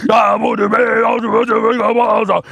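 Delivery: high shouted gibberish chant; angel voice shout. Pretend. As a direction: belt the light code syllables. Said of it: "That's my light code".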